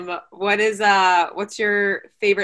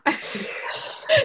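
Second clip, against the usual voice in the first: high squealing laugh; 7 dB quieter than usual. Real, not acted. Laughing at her messy hair.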